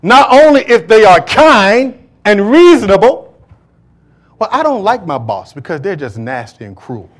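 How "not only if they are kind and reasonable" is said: loud, slow, high pulpit proclamation; thundering sermon read; preaching at full force. Real, not acted. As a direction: proclaim it to the congregation. Preacher reading scripture at force.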